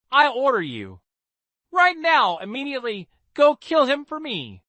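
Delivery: high, loud furious command; furious order. Acted. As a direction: scream the order at him.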